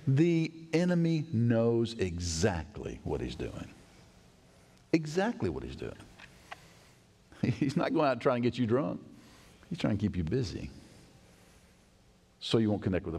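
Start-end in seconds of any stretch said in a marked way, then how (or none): none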